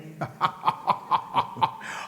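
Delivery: long staccato stage laugh; stagey laughing. Acted. Villain laughs at his opponent's wrong guess, staccato stage laughter. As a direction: laugh in measured, unvoiced ha-ha bursts. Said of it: "I'm laughing again".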